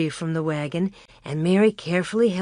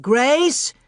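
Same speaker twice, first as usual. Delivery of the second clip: high calls, climbing; father calling for lost daughter; shouting; 8 semitones higher, 3 dB louder than usual. A narrator's reading. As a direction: shout her name twice. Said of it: "he shouted".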